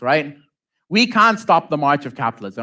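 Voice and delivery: ordinary talk, nothing marked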